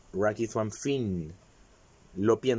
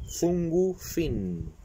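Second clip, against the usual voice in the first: "zungu fin" said very slowly, each syllable drawn out; slow demo; 5 semitones higher, much slower than usual. Shown as a demonstration. Teacher slows "zungu fin" for viewers, very slow. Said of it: "lo ocupa así".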